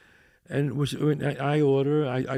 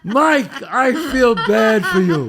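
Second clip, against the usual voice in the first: loud, slow, laughing commiseration; teasing mock pity; 5 semitones higher, 9 dB louder, slower than usual. Real, not acted. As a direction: draw it out high through laughter. Teasing co-host amid laughter.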